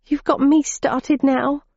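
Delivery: high, slow tearful complaint; crying, half laughing at it. Acted. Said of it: "started sniffing as large, salty tears".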